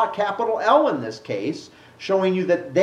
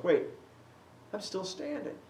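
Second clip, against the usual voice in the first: much softer, hushed dawning wonder; dawning realization; 4 semitones higher, 11 dB quieter than usual. Retold or imitated. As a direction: let it dawn on Paul, almost to himself. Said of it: "It begins to dawn on him".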